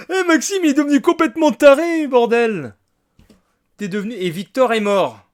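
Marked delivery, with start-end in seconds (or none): 0.1-2.7 high, fast laughing exclamation; giddy outrage; excited disbelief. Real, not acted. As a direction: squeal it out amazed.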